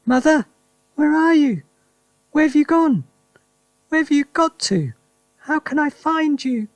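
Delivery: high, loud searching call; wife searching the house for her mother; puzzled calling. Acted. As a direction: call out, lost and bewildered. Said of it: "puzzled look on her face".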